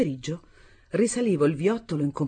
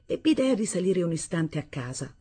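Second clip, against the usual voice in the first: ordinary delivery, nothing marked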